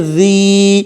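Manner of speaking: زی with a long, slow held ee; stretched madd. Shown as a demonstration. Teacher spelling out zaal and ya, vowel stretched. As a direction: draw out the vowel of زی evenly. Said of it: "ایک الف کی مقدار کھینچ کر پڑھیں گے".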